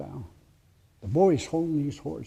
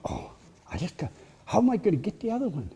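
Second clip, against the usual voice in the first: greedy fretting; 5 semitones higher, faster than usual. Acted. Greedy boy torn over horses.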